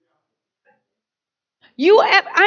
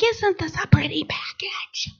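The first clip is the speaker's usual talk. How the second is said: admiring whisper; 8 dB quieter than usual. Retold or imitated. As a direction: breathe it like you're enchanted by the wrapping. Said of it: "And we're like".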